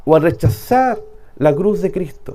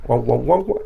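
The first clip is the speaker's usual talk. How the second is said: Charlie Brown teacher wah-wah; 6 semitones lower, 4 dB quieter than usual. Retold or imitated. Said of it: "La profesora que hablaba detrás".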